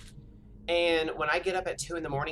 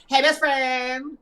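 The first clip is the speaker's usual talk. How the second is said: loud, high, drawn-out hey best friend; hollered greeting; 8 semitones higher, 8 dB louder, much slower than usual. Retold or imitated. Greeting her best friend whenever they meet, joyful holler. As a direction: holler it across the room like a happy reunion. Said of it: "we always holler".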